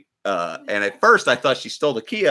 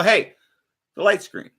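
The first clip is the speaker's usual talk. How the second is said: polite nudge; 7 semitones higher than usual. Retold or imitated. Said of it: "just to let 'em know".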